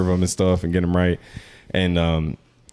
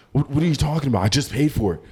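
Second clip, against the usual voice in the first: higher-pitched imitation of an irate customer; mock angry rant; 8 semitones higher than usual. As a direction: go off indignantly, without really shouting. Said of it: "going the fuck off on the manager".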